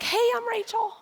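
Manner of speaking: high-pitched imitated hello; light, high quoted greeting; friendly greeting. Voices a friendly woman introducing herself.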